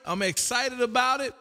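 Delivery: usual speaking voice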